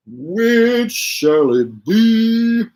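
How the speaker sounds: high, very slow theatrical ask; dramatic question. Pretend. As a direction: draw out each word like a showman.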